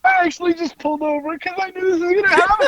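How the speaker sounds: high, squeaky crying with laughter; helpless laughter. Real, not acted. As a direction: struggle to talk through real laughing tears. Helpless laughter while telling the story.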